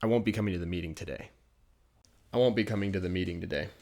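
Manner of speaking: fast, relaxed native phrasing; quick casual demo. Shown as a demonstration. Teacher models the casual excuse for students, quick and easy. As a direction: rattle it off casually. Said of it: "You would say".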